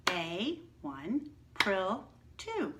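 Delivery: put-on teacher counting claps with kids; counting syllables. Shown as a demonstration. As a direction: split April into beats and count each one. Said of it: "How many claps".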